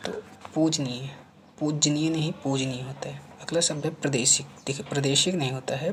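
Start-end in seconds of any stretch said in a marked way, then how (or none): none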